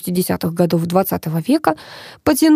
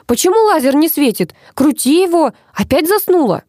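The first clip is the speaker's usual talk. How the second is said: high-pitched irritated scolding; cross; 9 semitones higher than usual. A narrator's reading. Mother scolds daughter for sleeping on the job, cross. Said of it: "рассердилась Алиса".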